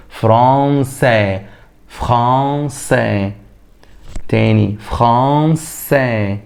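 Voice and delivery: فرانسي slowly, s in the middle, final sound silent; slow repeat drill. Shown as a demonstration. Teacher drilling فرانسي with students. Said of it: "هقول إيه؟".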